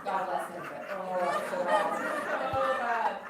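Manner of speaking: loud, laughing disbelief. Real, not acted. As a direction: laugh through the words at how bad it was.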